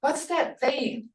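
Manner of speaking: high, questioning "What is that?"; curious question voice; asking tone. Shown as a demonstration. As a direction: ask it like you're puzzled. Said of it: "like something you're asking".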